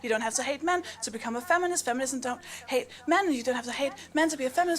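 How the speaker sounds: weary rote recital. Retold or imitated. Rattles off the repeated feminist mantra.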